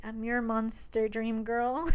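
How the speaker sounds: slow, hushed menace; creepy captor. Retold or imitated. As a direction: draw it out softly, possessively.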